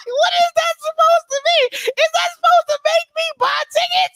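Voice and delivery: can't believe what he just heard; incredulous outcry. Real, not acted. Mocking the promo, crying out in high disbelief.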